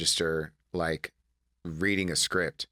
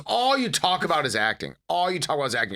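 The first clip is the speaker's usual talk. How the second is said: exasperated mom; 7 semitones higher, 3 dB louder than usual. Retold or imitated. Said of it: "She goes".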